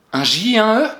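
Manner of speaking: a high, loud, mock-innocent "Un J et un E?"; mock naive guess. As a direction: play the pupil who guesses wrong. Teacher voices a wrong pupil guess.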